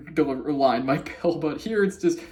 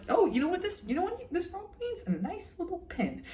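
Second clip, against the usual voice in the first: much higher and softer, delighted; ecstatic Marcy; 13 semitones higher, 7 dB quieter than usual. Retold or imitated. Marcy in the king's pocket, high, soft and delighted. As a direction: gush over a tiny treasure. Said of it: "beyond ecstatic".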